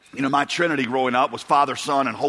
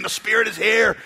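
worship leader proclaiming; 5 semitones higher than usual. Retold or imitated. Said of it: "he kept saying".

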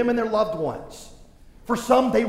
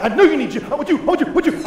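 exasperated dad; 9 semitones higher, 8 dB louder, much faster than usual. Retold or imitated. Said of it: "And I'm like".

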